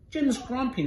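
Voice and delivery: high sing-song tattling voice; tattling neighbour. Acted. Norman tells Lamore on Jim, high sing-song tattle. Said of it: "Norman told Lamore".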